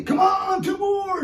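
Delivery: mock drill-sergeant trainer; trainer yelling. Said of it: "a trainer yelling at me".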